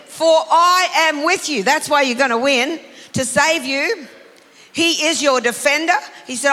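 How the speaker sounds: loud, high, fervent preaching to the congregation; full-force preaching. Acted. Preacher assuring the church they will win, booming.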